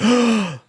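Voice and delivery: sharp high gasp like coming up from drowning; sudden loud gasping breath; gasp for air. Retold or imitated. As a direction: suck in air as if surfacing from water. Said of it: "You're like".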